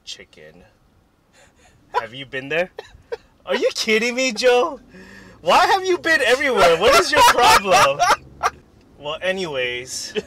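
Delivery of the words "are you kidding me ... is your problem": high, loud exasperated rant; mock outrage. Host scolding Joe for having been everywhere, high loud mock outrage. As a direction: blow up in playful indignation.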